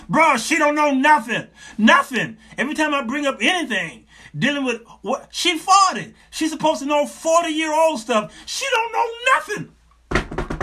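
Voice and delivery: loud, high, hammed-up venting; exasperated boyfriend. Voices exasperated man venting about girlfriend.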